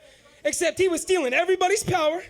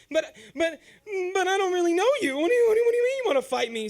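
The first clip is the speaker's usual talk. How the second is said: rising, louder, rushing stammer; panicked kid backing out fast; 4 semitones higher, 4 dB louder, faster than usual. Retold or imitated. Nervous teen pleading with his uncle, stammering and panicked.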